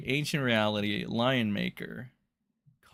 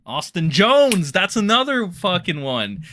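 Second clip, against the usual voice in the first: excited shout; 6 semitones higher, 8 dB louder than usual. Real, not acted. Streamer suddenly remembering another name, loud excited shout.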